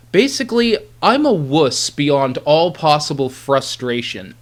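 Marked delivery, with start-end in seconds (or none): none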